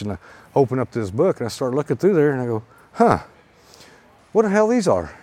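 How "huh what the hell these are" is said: high, puzzled huh of disbelief; baffled surprise. Retold or imitated. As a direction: react like the book makes no sense. Baffled on opening the book.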